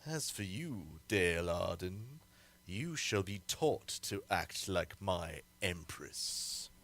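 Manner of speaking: low, soft sinister threat; quiet menace. Acted. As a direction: purr the threat at the captive. Tyrant threatens captive bride.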